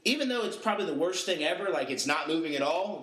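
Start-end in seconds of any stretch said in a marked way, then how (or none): none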